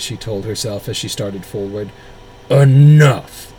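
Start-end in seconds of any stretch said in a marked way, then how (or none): 2.5-3.5 huge commanding shout; thunderous booming voice. Acted. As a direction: boom it over a whole crowd. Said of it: "A thunderous voice boomed".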